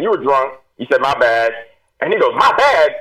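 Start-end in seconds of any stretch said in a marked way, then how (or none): 2.4-2.9 higher, louder, offended disbelief; indignant echo. Retold or imitated. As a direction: throw the words back, outraged. Offended sergeant echoes the apology.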